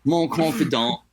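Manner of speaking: put-on French said with a California trucker accent. Mocking his own French, in a California trucker accent. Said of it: "It sounds like a trucker from California".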